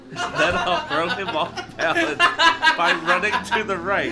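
high, loud, slow reading through laughter; cracking up. Real, not acted. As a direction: struggle to read while laughing hard. Reader cracks up at silly plan.

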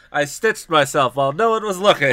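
high, loud, through real laughter; real giggles breaking into the quote; laughing while reading. Real, not acted. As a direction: push the line out while cracking up. Reader cracks up mid quote.

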